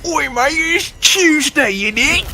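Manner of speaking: very high, drawn-out British caricature; mock British accent. Joining in mocking the British friend, hammed-up British accent. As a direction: squeak out a slow, silly British accent. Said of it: "It sounded like".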